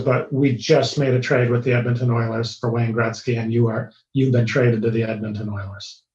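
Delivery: low, heavy delivery of bad news; somber retold call. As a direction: break it to him somberly, pitch sunk low. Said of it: "He was very somber".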